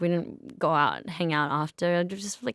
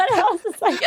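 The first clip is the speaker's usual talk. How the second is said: laughing; 13 semitones higher, 6 dB louder, faster than usual. Real, not acted. Laughing while retelling.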